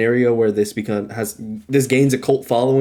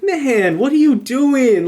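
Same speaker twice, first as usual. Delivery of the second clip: incredulous; 12 semitones higher, slower than usual. Retold or imitated. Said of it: "And I'm like".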